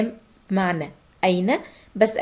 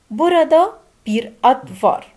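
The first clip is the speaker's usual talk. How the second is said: very slow and louder, each word separate; slow louder Turkish demo; 4 semitones higher, 6 dB louder, much slower than usual. Shown as a demonstration. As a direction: say it slowly for students to repeat.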